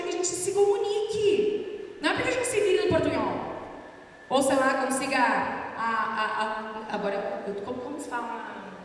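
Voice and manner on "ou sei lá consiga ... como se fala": hesitant, halting, fading to a low murmur; floundering learner. Acted. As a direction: grope for the words, repeating and stalling. Acts out a floundering language learner.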